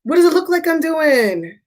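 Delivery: mock exasperation at the question; exasperated retort. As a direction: drag out "I'm working" in irritation. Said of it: "And I'm like".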